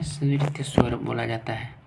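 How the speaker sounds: ordinary talk, nothing marked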